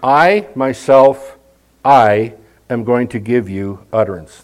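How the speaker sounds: God's own emphatic promise. Shown as a demonstration. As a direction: lean hard on I, myself. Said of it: "there's something that is in the emphatic".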